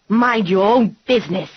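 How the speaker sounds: put-on old woman yelling at Grandma; yelled. A narrator's reading. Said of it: "the woman yelled".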